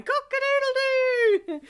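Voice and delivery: very high, loud cock-a-doodle-doo; loud high rooster call; rooster crow. Retold or imitated. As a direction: crow like a cockerel. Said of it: "I can hear someone going".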